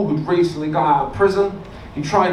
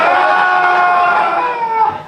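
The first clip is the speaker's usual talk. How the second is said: mock laughing shout; 10 semitones higher, 4 dB louder than usual. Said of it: "He was like".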